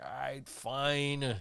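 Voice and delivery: drawn-out, grudging giving in; reluctant agreement. Retold or imitated. Narrator finally agreeing to do two character voices, reluctant. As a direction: give in slowly, stretching out "fine".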